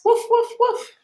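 high little woofs; quick cheerful yaps; short little barks. Pretend. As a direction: bark like a tiny happy dog. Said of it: "doing his short little barks".

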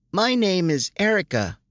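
put-on high child's voice; a small girl telling her name; little girl.

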